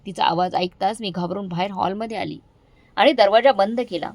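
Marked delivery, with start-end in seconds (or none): none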